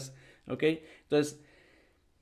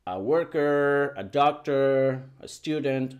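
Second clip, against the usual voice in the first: "A worker, a doctor, a student" spoken slowly and clearly for repetition; slow model words; slower than usual. Shown as a demonstration. Teacher modelling words for students to repeat. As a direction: model each word slowly for the class. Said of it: "la palabra que vas a usar es A".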